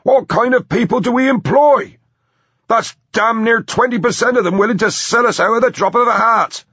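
very high, loud tirade; outraged shouting. A narrator's reading. Boss learning his workers sold out, outraged shouting. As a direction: rant at full shout in a high voice. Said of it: "Phillips shouted".

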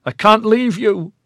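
high anguished cry. A narrator's reading. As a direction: cry it out into the night. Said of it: "he cried into the terrible night".

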